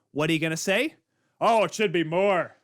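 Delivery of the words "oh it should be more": lightly put-on voice for a quoted player; half-hearted stand-in for the player's complaint; Ovechkin. Host imagining the star player's reaction, half-hearted impression. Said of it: "Or however the heck his accent is".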